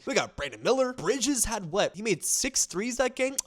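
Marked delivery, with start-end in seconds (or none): none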